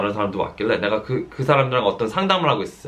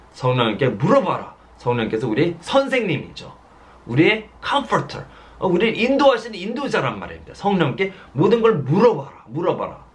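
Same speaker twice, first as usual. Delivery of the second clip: raised, insistent preacher's call; fervent preaching; 6 semitones higher than usual. Real, not acted. Pastor urging the congregation to ask the Holy Spirit, fervent. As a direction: urge the flock to ask the Spirit.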